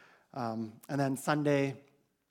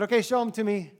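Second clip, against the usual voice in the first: higher and louder, half joking; mock stern demand; 8 semitones higher, 7 dB louder, faster than usual. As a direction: call it out like a teasing leader. Leader demanding to see passports.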